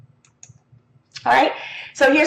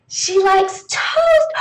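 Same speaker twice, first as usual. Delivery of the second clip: put-on slow, higher child answer; child's answer; 8 semitones higher, much slower than usual. Shown as a demonstration. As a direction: answer slowly like a kid.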